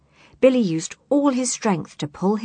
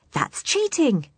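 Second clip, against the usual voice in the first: yelled; 9 semitones higher than usual. Acted. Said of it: "yelled Billy".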